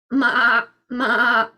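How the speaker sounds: short wavering goat bleats; goat's bleat. A narrator's reading. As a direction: bleat like a goat at your own pitch. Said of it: "said the goat".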